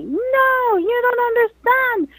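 drawn-out, high wail of protest, acted; retold yell. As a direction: imitate her desperate cry without full volume. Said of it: "she yelled at me".

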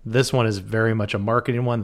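ordinary delivery

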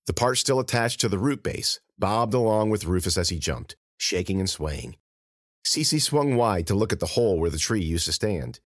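plain reading voice

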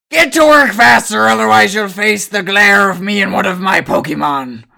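loud high bossy command; stern foreman shout. Acted. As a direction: yell orders at slacking workers. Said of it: "You guys hear him say".

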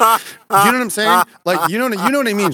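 high, loud insistent question; emphatic. Real, not acted. To Jason, a high, loud pressing appeal.

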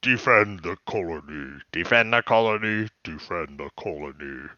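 low, fading distorted robot repeat; voice through static. Retold or imitated. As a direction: drone it like a broken radio. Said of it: "repeating in a distorted voice".

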